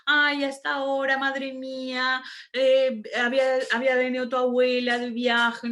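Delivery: high fond reminiscing mother voice; a mother's nostalgic sighing recollection, high and unlike her own voice; imitating mother. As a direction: gush like a mum recalling her children's birth. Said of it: "les contaba a mis hermanos".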